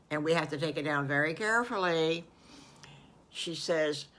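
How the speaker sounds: ordinary delivery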